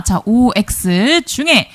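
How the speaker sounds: slow, stretched 오엑스 중에 building suspense; drawn-out suspense. Acted. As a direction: draw out 오엑스 중에 slowly for suspense. Suspense before answer reveal.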